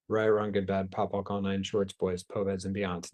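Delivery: quiet, rapid ritual recital of the clearing statement. Acted. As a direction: rattle off the formula softly, like an incantation.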